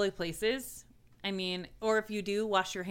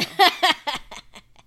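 loud high laugh; 10 semitones higher, 11 dB louder than usual. Real, not acted. Laughing at own boast.

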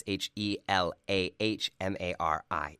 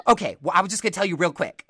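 higher, louder, fast pushy chatter; mock pushy relative; 11 semitones higher, 9 dB louder, faster than usual. Relative pushing on anyway, fast and louder. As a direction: rush past her answer.

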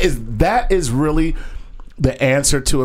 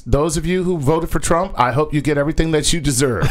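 ordinary delivery

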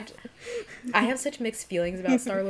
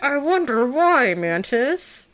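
loud, high, drawn-out sarcasm; mock exasperation; 8 semitones higher, 10 dB louder, much slower than usual. Embarrassed friend answers tactless Mantis, loud mock exasperation. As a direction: throw it back loudly, hammed-up and exasperated.

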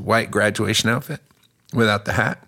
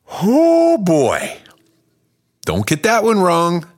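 loud warning; 9 semitones higher, 5 dB louder, slower than usual. Real, not acted. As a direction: shout the warning high.

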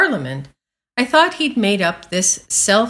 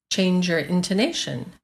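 ordinary talk

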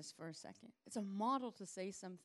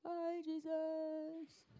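high, very slow farewell; wistful goodbye; 10 semitones higher, much slower than usual. Acted. Disciples waving Jesus off into the sky, high and slow. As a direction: draw out the goodbye.

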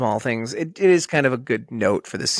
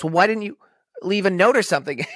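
acted protest; 7 semitones higher, faster than usual. Acted retort from Dan.

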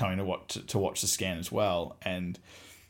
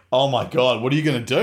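louder mock-alarmed mum; shocked realisation; 2 semitones higher, 7 dB louder, faster than usual. Mum realising her son has twins, alarmed. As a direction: blurt it with rising alarm.

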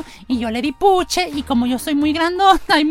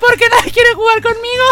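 mock crying; 7 semitones higher, 4 dB louder than usual. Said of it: "No llores".

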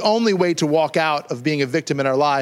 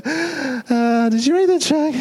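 sweet-talking nephew; 8 semitones higher, slower than usual. Pretend. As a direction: fake sweetness to the rich aunt. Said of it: "call her on the phone and say".

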